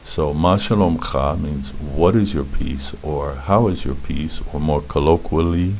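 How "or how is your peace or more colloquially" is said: ordinary talk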